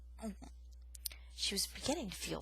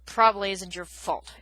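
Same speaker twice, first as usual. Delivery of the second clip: grumbling; 9 dB louder than usual. Acted. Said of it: "Aerith grumbled in a somewhat softer tone".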